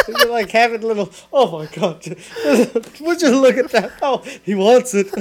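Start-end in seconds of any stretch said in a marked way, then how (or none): 1.3-5.1 loud, laughing imitation of a delighted dad; mock dad marvelling through his laughter; cartoonish dad. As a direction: exclaim like an over-amused cartoon character. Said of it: "He sounds like a Disney character".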